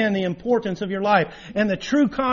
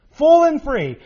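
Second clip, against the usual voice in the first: much higher and louder, ringing; exultant outburst; 9 semitones higher, 9 dB louder than usual. Real, not acted. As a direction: burst out with joy on the offer. Preacher peaks on pardon offered.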